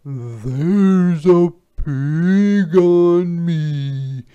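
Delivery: slow, loud, mooing cow; high, drawn-out storybook cow. A narrator's reading. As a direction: stretch every word like a moo. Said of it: "said the cow".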